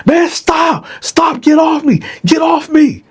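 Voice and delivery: very high, frantic shout; panicked shout. Retold or imitated. Dylan shouting at Bone to get off him, frantic high shout. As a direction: scream it out, fighting him off. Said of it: "Then I hear".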